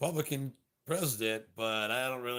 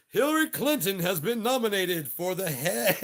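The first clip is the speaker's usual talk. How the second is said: laughing mock crowd; 10 semitones higher, 9 dB louder than usual. Crowd mocked as cheering.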